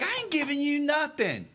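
high defiant retort. Retold or imitated. Herb refusing the caller's demand, defiant.

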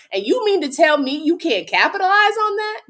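incredulous rant. Real, not acted. Ranting at team.

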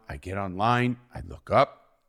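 usual speaking voice

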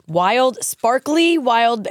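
sparkly drawn out slowly; stress on sparkly. Shown as a demonstration. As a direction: stretch out sparkly wild. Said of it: "it is sparkly wild berry".

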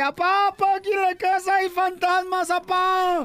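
put-on high child's voice calling dad; a kid begging his father, high and pushed; scared little kid. Child telling his dad about ghosts, high and pleading.

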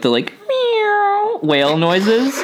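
high, slow pinched squeal; strangled whale noise. Retold or imitated. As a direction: squeeze out a whale call like a cat's meow. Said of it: "your whale sounds like a cat".

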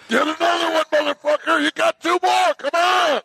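high, strained gym-coach shout; drill sergeant. Acted. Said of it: "is that the drill sergeant".

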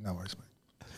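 a hushed, breathy whisper. Retold or imitated. Telling his mate about the coffee, whispered. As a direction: whisper it so the cameras won't catch it.

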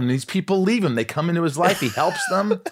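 high, loud hammed-up indignation; host mock-defends the abandoned agent, high and loud; mock outrage. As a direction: wail it like a wronged friend. Mock outrage on Sid's behalf.

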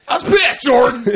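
hammed-up high screech to a friend; very high, loud outburst breaking into laughter; pissed-off yell. Real, not acted. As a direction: shriek the grievance, loud and comic. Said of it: "I'm still mad about it".

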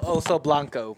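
Spanish words in an American accent. Real, not acted. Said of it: "in an American accent".

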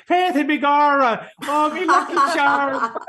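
high, loud hammed-up stage Irish brogue; stage Irish leprechaun. Host mocks how Irish visitors supposedly talk: hammed-up Irish leprechaun voice. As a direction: ham up a cartoon leprechaun in a thick Irish lilt. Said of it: "talk like a leprechaun".

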